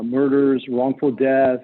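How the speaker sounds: ordinary talk, nothing marked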